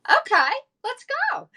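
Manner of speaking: sudden high, thrilled agreement; spontaneous high-pitched okay; eager yes. Retold or imitated. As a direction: jump at the invite, squealing. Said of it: "I'm like".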